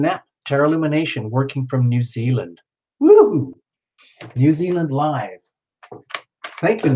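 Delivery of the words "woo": high, loud cheer of delight; excited woo. Real, not acted. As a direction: whoop for the viewer. Cheering a viewer from New Zealand.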